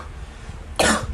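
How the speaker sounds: dry cough from an itchy throat. Real, not acted. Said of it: "Sorry about my cough".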